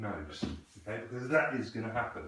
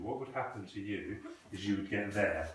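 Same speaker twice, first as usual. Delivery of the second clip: usual speaking voice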